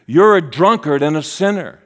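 accusers. Acted. Pharisees and scribes taunting Jesus, loud accusation. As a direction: fling the charge out loudly. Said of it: "they would say".

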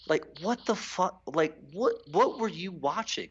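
can't believe what they're seeing; exasperated disbelief. Real, not acted. Ranting about players' delusional tweets.

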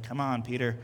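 low, soft chiding; retold mild reproach. Jesus to Peter after the ear, quietly chiding. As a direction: play a weary low-key telling-off.

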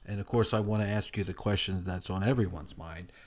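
ordinary talk, nothing marked

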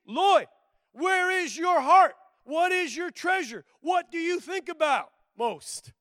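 high, loud and slow, calling out a name; booming voice of God. Retold or imitated. Preacher voicing God calling his name loudly. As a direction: thunder the questions down from above. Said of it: "If he said to me".

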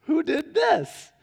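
high-pitched, drawn-out mock wondering; mock puzzlement. Family finding the muffin tops missing, mock puzzlement. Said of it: "And we're like".

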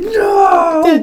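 high, loud wailing cry; mock anguished scream. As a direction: howl it in hammed-up despair. Audience wail as credits roll.

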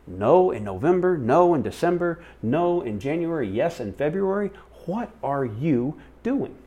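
high singsong listing, slowing at the end; acted bewildered complaint, pitched high; exasperated. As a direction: tick off each one in baffled protest. Retold exasperated complaint to God.

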